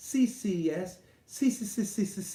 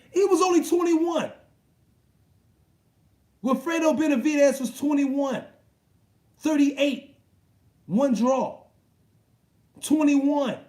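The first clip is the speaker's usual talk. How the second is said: higher and louder, hammering the numbers; amazed shout; 7 semitones higher, 6 dB louder than usual. Real, not acted. Streamer telling chat Benavidez's young age, amazed shout. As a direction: shout the record in disbelief.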